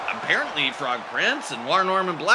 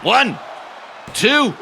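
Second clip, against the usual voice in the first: rising, louder count; excited count; 9 semitones higher, 4 dB louder than usual. Acted. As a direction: count the pin with building hype. Counting a pinfall.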